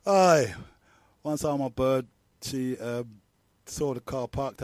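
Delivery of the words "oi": loud calling "Oi" after a laugh. Retold or imitated. Girlfriend calling to him in the street, a loud call.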